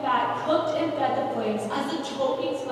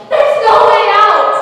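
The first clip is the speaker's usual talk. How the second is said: desperate yell, much higher and louder; 9 semitones higher, 16 dB louder than usual. A narrator's reading. Old lady blocking the sailor's path, despairing yell. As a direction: cry it out in despair. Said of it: "turned and yelled".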